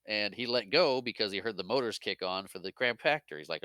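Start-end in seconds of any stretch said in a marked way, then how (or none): none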